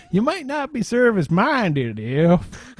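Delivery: slow, high Southern twang; Southern accent. Retold or imitated. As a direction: lay on a thick Southern drawl. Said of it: "My Southern accent".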